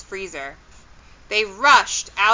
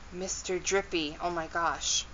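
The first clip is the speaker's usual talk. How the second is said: hushed shock; 2 semitones lower, 11 dB quieter than usual. Real, not acted. Reader reacts to Mister Drippy reveal, hushed and amazed. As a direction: murmur it in disbelief to yourself.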